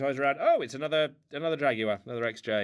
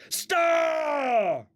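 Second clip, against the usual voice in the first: loud, high, full shout; 7 dB louder than usual. Real, not acted. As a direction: bellow the name across the house. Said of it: "I've been doing a lot of shouting".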